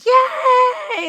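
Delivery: high, loud happy yell; kids cheering. Retold or imitated. Kids at the park cheering for her video, high and loud. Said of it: "And they all like".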